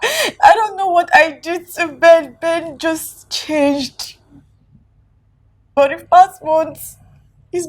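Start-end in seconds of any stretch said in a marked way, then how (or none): 0.0-4.2 wife crying over Ben's change. Acted. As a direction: let the tears break through. Woman crying about husband.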